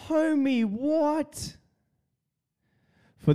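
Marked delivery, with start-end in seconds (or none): 0.1-1.6 very high, drawn-out mock squeal; high-pitched mimicry. Imitating a viral video for his listeners, high-pitched mimicry.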